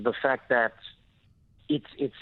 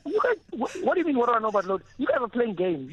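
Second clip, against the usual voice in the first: mock indignant; 9 semitones higher than usual. Real, not acted. Mock outrage at load shedding tease.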